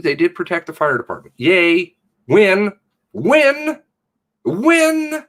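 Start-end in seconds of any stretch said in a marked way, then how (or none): 1.4-5.2 high, loud mocking "yay, win"; sarcastic cheer. Real, not acted. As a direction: cheer it like a bitter joke.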